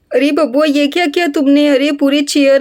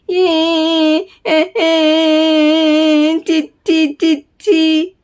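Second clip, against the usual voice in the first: put-on whiny baby voice; much slower than usual. Baby whines to brother.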